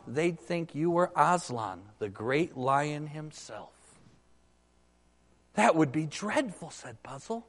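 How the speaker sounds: lively read-aloud voicing Shift and Puzzle; animated storybook reading of the ape and donkey; story reading. Preacher reads Narnia story aloud.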